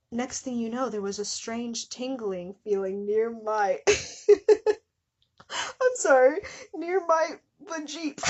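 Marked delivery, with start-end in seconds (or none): 5.5-8.2 high, breaking into laughter; can't keep a straight face; real giggling apology; laughing through it. Real, not acted. Cracking up while reading.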